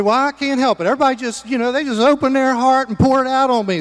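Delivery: lightly mocking high voice of an excuse maker; mock whiny excuse. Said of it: "You say".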